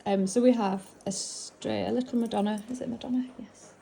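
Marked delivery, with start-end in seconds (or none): 2.7-3.7 whispered question to herself; whispering. Real, not acted. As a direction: ask it under your breath. Asking herself quietly.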